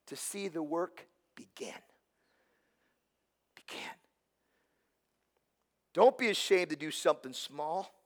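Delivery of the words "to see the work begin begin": whisper. Acted. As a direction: drop to a soft whisper. Whispered scripture after loud cheer.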